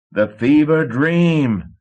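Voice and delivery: slow, high, loud bellow; captain bellowing at the clerk. A narrator's reading. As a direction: roar the boat's name. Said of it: "Marsh bellowed".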